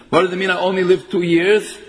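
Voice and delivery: ordinary talk, nothing marked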